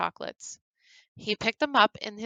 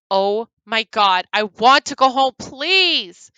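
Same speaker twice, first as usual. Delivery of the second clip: loud, rising panicked begging; hysterical plea; 3 semitones higher, 9 dB louder, slower than usual. Acted. Bree begging to leave the horror room, panicked. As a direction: beg to go home in terror. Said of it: "began hysterically crying".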